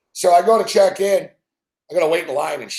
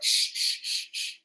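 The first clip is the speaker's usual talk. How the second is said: repeated soft "shh" hushes; imitated shushing; 12 dB quieter, slower than usual. Agent silencing the complaining passenger, imitated shushing. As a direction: shush someone in short, voiceless bursts. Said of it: "she just went".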